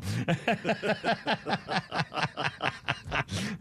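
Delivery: long rolling laugh; helpless laughter. Real, not acted. Host losing it over the roaches, laughing. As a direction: laugh on and on until spent.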